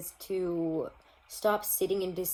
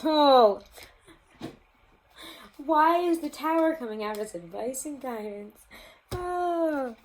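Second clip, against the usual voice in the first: shocked exclamation; 7 semitones higher, 7 dB louder, slower than usual. Real, not acted. Shocked at Tower card appearing.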